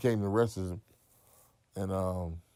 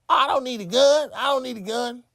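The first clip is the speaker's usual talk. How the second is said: much higher and louder, chest out; defiant boast; 13 semitones higher, 5 dB louder than usual. Retold or imitated. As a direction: tell him you fight bare-handed. Boasting at the gunman.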